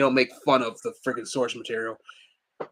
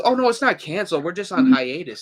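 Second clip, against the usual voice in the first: retold denial; 6 semitones higher, 5 dB louder than usual. Voices showrunner's breezy denial.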